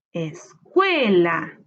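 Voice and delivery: load the voice onto the CUE of "Escuela"; low es, then long, punched CUE in "Escuela"; stressed syllable stretched. Shown as a demonstration. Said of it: "es la sílaba tónica".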